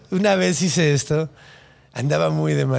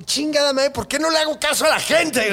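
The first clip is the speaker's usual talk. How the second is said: panicked regret; 9 semitones higher than usual. Retold or imitated. Man realizes he became a seal.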